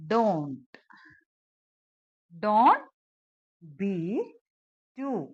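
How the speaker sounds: slow dictation, long gaps between words. Shown as a demonstration. Teacher building an English translation for learners, word by word. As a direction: set each word down separately.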